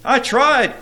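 high, loud defensive wail; quivering. A narrator's reading. Carnal Courtship answers the angry prince, acted quivering plea. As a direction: plead it in acted fright. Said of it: "quivered Carnal".

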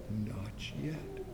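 not yet breathed as a soundless whisper; mouthed whisper. Acted. Master mouths through the oven door, whispering. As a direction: barely mouth the words. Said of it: "I could read his lips".

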